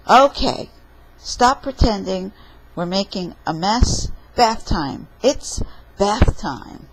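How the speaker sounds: loud storybook dad calling a halt; firm parent. Acted. Papa stopping a messy dinner game, loud and firm. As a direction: cut the game off loudly and firmly.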